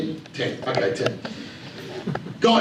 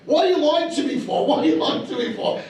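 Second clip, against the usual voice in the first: put-on much higher, suspicious old mother; accusing mum; 10 semitones higher than usual. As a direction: play the confused mother accusing her son, pitched up.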